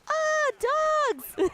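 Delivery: put-on high, loud gleeful kid squeal; over-the-top mimicked kids' yell; crazy excited kids. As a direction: imitate children shrieking with excitement. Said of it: "like, crazy".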